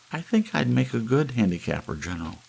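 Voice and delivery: high pleased Hazel; Hazel's voice. A narrator's reading. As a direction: boast it proudly.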